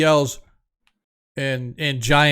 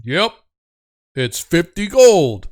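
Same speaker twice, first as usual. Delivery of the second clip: stubborn giant; 3 semitones higher, 5 dB louder than usual. Acted. Giant answers the haggling player.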